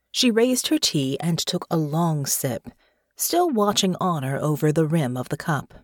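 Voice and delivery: usual speaking voice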